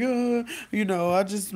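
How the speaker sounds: high, slow, lightly acted whimper; half-hearted weepy hedging; mock tearful. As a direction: stall in a mock-crying high voice. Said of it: "You're crying. You're like".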